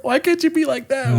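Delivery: very high, whining reproach; husband nagging wife, very high and whiny; mock whiny husband. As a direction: whine it like a spoiled husband.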